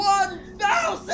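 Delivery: high loud slow shouted bid; shouting bid. Acted. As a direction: bellow the bid over the crowd. Bidder shouting in auction.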